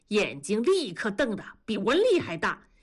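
plain reading voice

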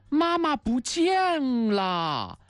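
high, long drawn-out cry of dismay; storyteller's drawn-out alarm for children; dismayed discovery. A narrator's reading. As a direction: stretch each word out high, then let it fall. Narrator voices discovering mother is missing.

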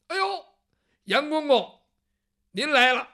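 high, loud eager greeting; fawning guard. Acted. Gate guard loudly greets the eunuch, high and fawning.

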